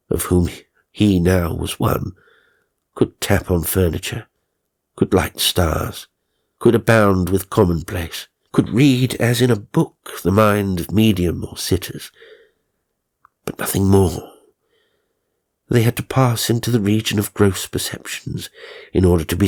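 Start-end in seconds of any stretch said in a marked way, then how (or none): none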